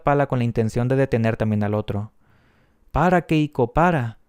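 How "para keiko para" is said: put-on girls begging, slightly raised pitch, no real crying; mild tearful plea. A narrator's reading. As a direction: plead with a light touch of distress. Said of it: "me suplicaban las niñas llorando". (súplica llorosa, levemente actuada)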